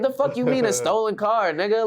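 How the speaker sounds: high-pitched incredulous retort; worked-up retold protest; indignant disbelief. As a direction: act out refusing to believe the accusation. Disputing the stolen car claim.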